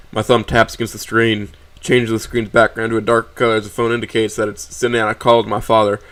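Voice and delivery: usual speaking voice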